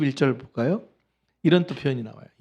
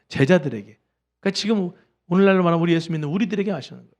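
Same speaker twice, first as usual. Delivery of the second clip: ordinary talk, nothing marked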